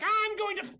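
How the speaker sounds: high, loud threat cut mid-sentence; villain cut off. Acted. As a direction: shriek it and stop dead. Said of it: "sort of mid-sentence, he's like".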